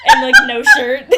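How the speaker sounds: loud laughing blurt; words bursting through a laugh. Real, not acted. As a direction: crack up mid-phrase.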